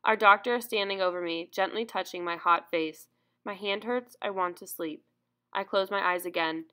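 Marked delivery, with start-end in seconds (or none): none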